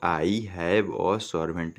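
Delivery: each word read slowly and clearly; slow dictation pace; slow reading aloud. Shown as a demonstration. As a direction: spell the sentence out slowly for the class. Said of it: "क्या लिखा हुआ है यहाँ पर".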